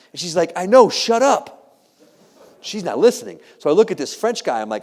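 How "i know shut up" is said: high, loud, drawn-out mock exasperation; exasperated wife. Wife silencing her husband in the museum, exasperated. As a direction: shut him down slowly and loudly.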